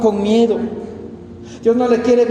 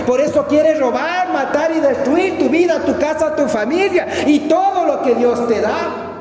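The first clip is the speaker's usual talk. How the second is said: raised, higher preaching voice building to a shout; fervent preaching; 7 semitones higher than usual. Acted. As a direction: preach it hard to the congregation. Preaching at full force. (predicación fervorosa, en voz alta)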